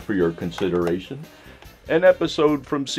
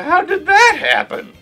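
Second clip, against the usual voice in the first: shrill, loud disbelieving squawk; much higher, shouted surprise; surprised; 8 dB louder than usual. Real, not acted. Outburst at plot twist.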